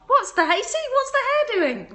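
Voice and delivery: high, loud squeal at her hair; sudden high exclamation of surprise; surprised squeal. Real, not acted. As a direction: shriek in startled delight.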